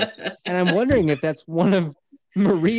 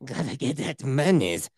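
mock greedy voice; 5 semitones lower, 4 dB quieter than usual. Repeats in put-on voice.

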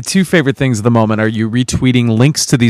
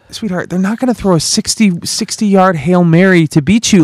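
higher-pitched, emphatic pleading with a sweetheart; retold incredulous reassurance; 7 semitones higher than usual. Husband retelling talk with wife, incredulous and higher. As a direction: talk her down, pitched up in disbelief.

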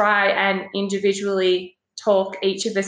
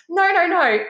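quick denial; 9 semitones higher, 4 dB louder than usual. Retold or imitated. Counsellor reassures quickly.

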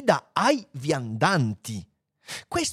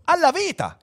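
high-pitched eager cry; excited exclamation; 4 dB louder than usual. A narrator's reading. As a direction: burst out with the answer. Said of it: "esclamò Fuchs". (esclamazione acuta ed entusiasta)

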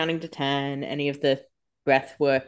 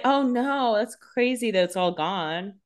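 feigned innocent shock, pitched up then drawn out; mock dismay; 4 semitones higher, slower than usual. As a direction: act badly surprised that it vanished. Acts out feigned shock over trust.

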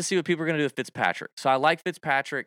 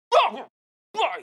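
mock frustrated blah; 13 semitones higher, 4 dB louder than usual. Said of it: "does he walk up to his ball and go".

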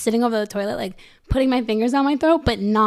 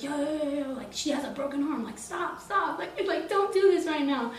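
calming friend; 5 semitones higher, 8 dB quieter than usual. Retold or imitated. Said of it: "Fonzie was like".

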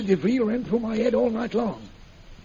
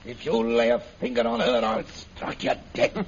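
low menacing threat; 8 semitones lower than usual. Acted. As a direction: promise to kill him, low and deadly. Threatens Bhutan to protect her.

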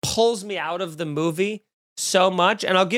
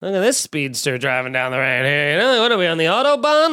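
mock grumbling driver. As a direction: build into performed indignation at a speeder. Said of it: "You gotta be like".

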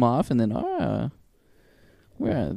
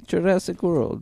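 mock Italian accent; 5 semitones higher, slower than usual. Trying film titles as Italian, mock accent. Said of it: "everything can be Italian".

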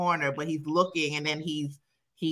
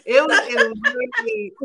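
ordinary talk, nothing marked

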